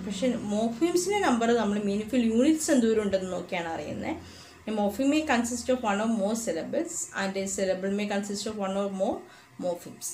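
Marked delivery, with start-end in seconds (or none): 4.7-10.1 slow, careful definition read in English; textbook reading. Shown as a demonstration. Teacher reading the definition to students, slow and deliberate. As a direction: read the definition out slowly to the class.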